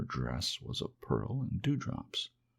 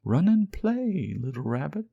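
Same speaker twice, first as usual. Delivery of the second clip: high joyful fairy; 10 semitones higher, 6 dB louder than usual. Acted. Fairy sending the little rabbit off to play, high and joyful. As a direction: release him to play with delight.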